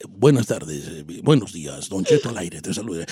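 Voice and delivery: very low, soft formal radio greeting; serious announcer voice. Shown as a demonstration. Don Cheto showing how he would greet listeners, serious announcer voice. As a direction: go deep and hushed, a proper broadcaster. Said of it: "si yo hablo así".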